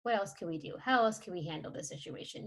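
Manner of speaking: soft, gentle parent talking it through; understanding parent. Pretend. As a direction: calmly reassure an upset child. Models calm parent answering upset child.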